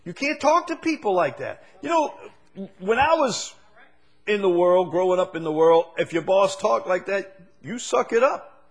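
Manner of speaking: high, loud preaching cadence; full-force preaching. Acted. Preacher to congregation on rude bosses, full-force preaching.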